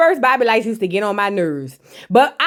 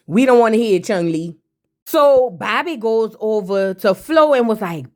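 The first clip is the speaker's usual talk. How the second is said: ordinary delivery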